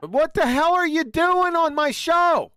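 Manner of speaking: loud, slow, exasperated demand; angry shout. Real, not acted. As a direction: yell it slowly at him, fed up. Host angry at a guest.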